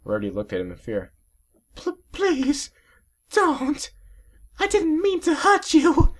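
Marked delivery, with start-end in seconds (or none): 1.8-6.1 very high, loud, slow pleading; begging for her life; high trembling plea; stammering desperate plea. Acted. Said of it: "she stammered out in pain and desperation".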